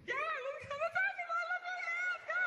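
very high squealing let's go; emoji excitement. Retold or imitated. Big smiley emoji squealing yes to the movies, very high. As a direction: squeal it like a giddy cartoon face.

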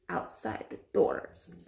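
hushed witch whisper. Acted. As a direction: drop to a sly whisper. Witch ends line whispered.

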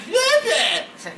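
high squeaky creature cry, laughing; imitating Slurpuff. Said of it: "You hear that?".

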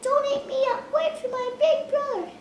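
squeaky high little goat; high pleading goat. Acted. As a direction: beg like a tiny goat.

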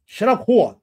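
loud mock New Jersey accent. Streamer reads Dark Gift's insult, loud New Jersey accent. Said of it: "with the New Jersey accent".

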